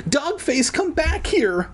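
high-pitched call to a runaway dog; hammed-up high holler. A narrator's reading. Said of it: "Evan called".

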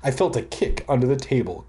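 ordinary talk, nothing marked

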